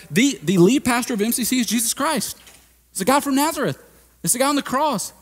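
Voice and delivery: high, loud, emphatic Jesus voice; paraphrasing Jesus. Retold or imitated. Pastor voicing Jesus telling the church he's in charge, high and emphatic. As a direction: play Jesus laying down who's in charge. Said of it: "Jesus' way of going".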